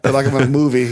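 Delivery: usual speaking voice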